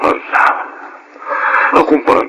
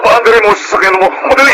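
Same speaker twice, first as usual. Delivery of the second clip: rushed, higher urgent command; 7 semitones higher, faster than usual. Acted. Wife urges husband to start the car, urgent. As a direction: hurry him to the car.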